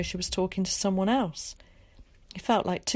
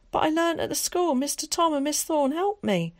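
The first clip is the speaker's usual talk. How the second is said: put-on lightly raised boyish voice, eager; a boy pleading with his mother, a little higher; young boy Willie; 10 semitones higher, 3 dB louder than usual. A narrator's reading. Willie explaining.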